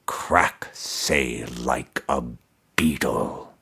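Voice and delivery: low, soft, toneless voice of a buried skull; resonant toneless skull. A narrator's reading. Buried emperor's skull answering Mordecai, low resonant toneless voice. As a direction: intone it without any tune, deep in the earth. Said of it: "answered in a resonant voice, toneless".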